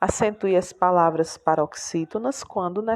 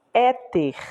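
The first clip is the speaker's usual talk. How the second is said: Éter with a higher, strong "É"; stress on first syllable; 4 semitones higher than usual. Shown as a demonstration. Teacher showing the penultimate is tonic, stress on "É" in Éter. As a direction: lift the "É" of Éter. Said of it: "É a penúltima".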